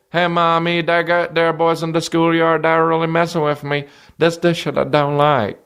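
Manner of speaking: put-on high-pitched child telling on bullies; complaining little kid. Kid tells mommy about the schoolyard, high whine.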